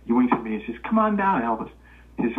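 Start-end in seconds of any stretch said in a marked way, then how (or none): 0.8-1.7 high, breezy mock invitation; playing along. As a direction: invite a supposed impostor, humouring him. Dad winks, humours supposed impostor.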